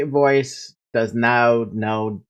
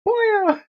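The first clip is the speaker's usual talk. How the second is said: squeaky animal call. Acted. Mimicking the animal noise.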